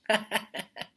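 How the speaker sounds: short amused laugh; real chuckle. Real, not acted. Teacher laughing at a kid's joke, amused chuckle. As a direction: laugh at the silly line.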